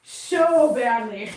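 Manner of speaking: drawn-out, slow "so badly"; mock emphasis. Real, not acted. Owner doubting the cat's injury, drawn-out mock emphasis. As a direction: stretch it sarcastically.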